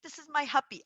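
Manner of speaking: put-on high, loud insistent little-kid voice; a toddler stubbornly insisting; mimicking a small child digging in; imitating her daughter. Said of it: "But she's like".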